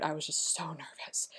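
breathy whisper of remembered nerves; hushed, nervous whisper. Real, not acted. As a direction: whisper it like a confession. Nervous days before meeting.

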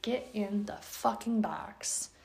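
slow, whispered, fed-up order; whispered command. Retold or imitated. Owner ordering dog into crate, slow whisper. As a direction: hiss the order through your teeth. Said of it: "I'm like".

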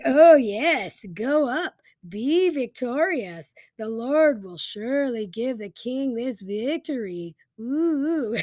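high, loud mock enthusiasm, drawn out; over-the-top fake cheering; sarcastic. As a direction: gush it with heavy sarcasm. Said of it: "Micaiah replies sarcastically".

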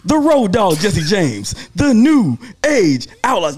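booming ring announcer intro; ringmaster. Retold or imitated. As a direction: stretch it out like an arena announcer. Announcing the tag team entrance.

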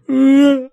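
high, loud wordless whoop; sudden high-pitched cry, imitating passing lads; imitated driver's holler. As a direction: mimic a shout from a passing car. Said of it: "I'll always hear this kind of".

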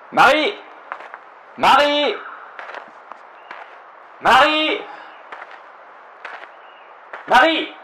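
long loud call across the woods. Acted. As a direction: shout her name into the trees. Search party calling missing woman.

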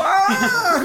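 high, loud mock gasp of shock; mock shocked shout. Friend hamming up a shocked cry at the punchline. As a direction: cry out in fake alarm.

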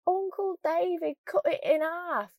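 put-on thick northern English accent, high and drawn out; exaggerated Northern English, slow and high-pitched; forte sotaque do norte de Inglaterra. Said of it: "trying to imitate a northern accent".

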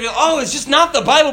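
hammed-up, brushing the Bible off; mock believer dismissing the Bible; mock dismissive voice. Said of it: "sometimes you just have".

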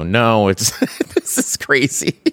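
high, laughing self-quote. Retold or imitated. Telling the host about show anxiety, laughing. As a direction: laugh at the craziness of it. Retelling own panic, laughing.

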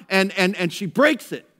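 ordinary talk

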